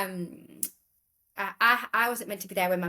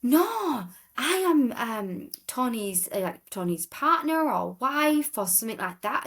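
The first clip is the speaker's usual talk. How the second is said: Polish lady flustered; 5 semitones higher, slower than usual. Retold or imitated. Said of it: "she's like".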